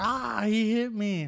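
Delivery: slow, whiny fake-hurt paparazzo; mock whiny victim. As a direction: ham up a fake injury moan. Mocking paparazzi faking injury.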